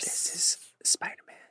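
secretive whisper. Pretend. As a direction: breathe it in a hush. Whispered joke.

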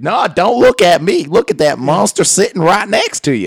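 high, loud mock scolding; hammed-up indignant TV host; sassy Doctor Phil. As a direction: snap at the guest with sass. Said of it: "he says it with a little sassy voice".

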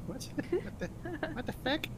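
high, stunned cry of disbelief; can't believe what she's reading; shocked. Acted. Shocked reaction, high pitch.

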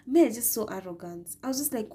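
normal talking voice

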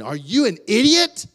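high, loud mock scolding; condemning parent. Mom or dad condemning their child, scornful and loud. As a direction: demand it like an exasperated parent. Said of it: "when mom and dad condemned you".

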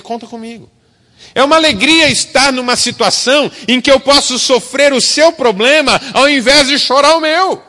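loud, high impassioned preaching; fiery pulpit delivery; forceful preaching. Acted.

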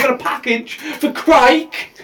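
imitated Cockney, "Crike" rhyming with "bike"; Cockney accent. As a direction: say "Crike" for Craig, as a Cockney would. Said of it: "he said it with his accent as Crike".

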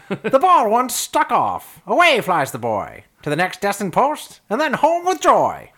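put-on high, loud old-timey recital. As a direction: declaim it like an old storybook. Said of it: "reread that in your old-timey voice".

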